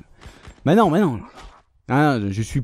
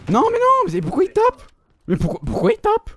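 outraged disbelief. Real, not acted. Enemy melees instead of shooting.

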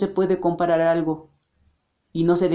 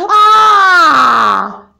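full-throated high scream of terror; loud scream; 9 semitones higher, 11 dB louder than usual. Acted. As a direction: scream with everything you have. Victim attacked by the ogre.

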